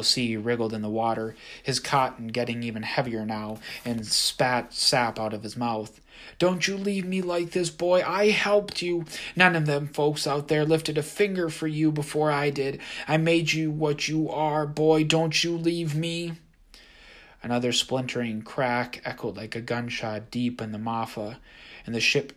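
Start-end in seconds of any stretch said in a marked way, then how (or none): 6.4-16.3 higher, louder desperate demand; guilt-trip him as you sink; pleading, bitter. A narrator's reading. Haint begs and blames, trapped.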